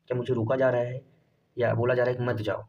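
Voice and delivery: ordinary talk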